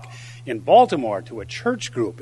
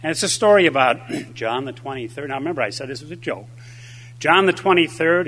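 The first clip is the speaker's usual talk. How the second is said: ordinary talk, nothing marked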